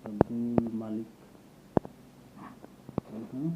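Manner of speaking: ordinary delivery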